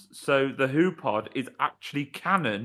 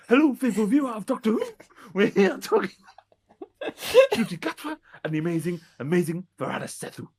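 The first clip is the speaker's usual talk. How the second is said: higher, louder badly faked Welsh accent; Russell T Davies; 5 semitones higher, 3 dB louder than usual. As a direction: gush in hammed-up mock Welsh. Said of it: "just me putting a voice on".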